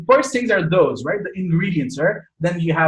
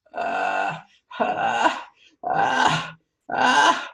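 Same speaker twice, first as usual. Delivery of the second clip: short repeated fry "uh" sounds; placed vocal fry; 5 semitones higher, much slower than usual. Shown as a demonstration. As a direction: creak "uh" placed up in the roof of the mouth. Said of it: "place that sound up there like a".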